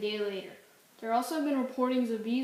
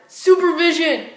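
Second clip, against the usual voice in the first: loud shouted warning, pitched up; 6 semitones higher, 16 dB louder than usual. Acted. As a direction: yell the warning at full volume.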